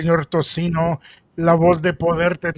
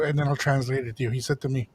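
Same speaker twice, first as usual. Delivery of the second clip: normal talking voice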